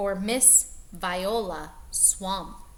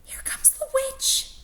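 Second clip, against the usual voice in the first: breathy unvoiced whisper, higher. Kids warning each other, whispered. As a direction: hush it like scared kids. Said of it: "they whispered".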